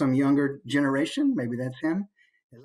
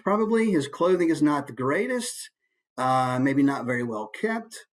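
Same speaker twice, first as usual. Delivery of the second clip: ordinary delivery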